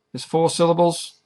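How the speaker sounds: ordinary talk, nothing marked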